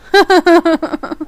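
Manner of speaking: short, high, loud chuckle burst; self-mocking laugh. Real, not acted. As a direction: laugh at your own accent.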